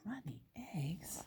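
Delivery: hushed unvoiced aside; whispered grossed-out aside. Real, not acted. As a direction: whisper it to yourself in disgust. Teacher aside, disgusted whisper.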